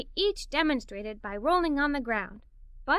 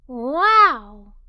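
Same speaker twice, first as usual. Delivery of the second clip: amazed wow; 2 semitones lower, 11 dB louder than usual. Acted. Awed reaction to discovery news.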